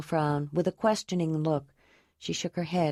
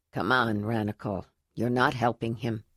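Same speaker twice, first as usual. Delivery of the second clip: lower, steady voice of a cave leader; firm leader's voice; 7 semitones lower than usual. Acted. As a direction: calmly pull the grieving man back.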